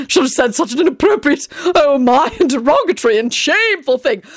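high, fluttery, fretting voice; a dithering gentleman wringing his hands; fussy, flustered Tony. A narrator's reading. Tony panicking to Teddy, flustered and high.

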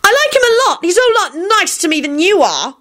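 put-on very high, insistent girl; high-pitched, indignant insistence; defiant shrieking. A narrator's reading. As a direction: argue back in a high, stubborn voice. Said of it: "she shrieked back defiantly".

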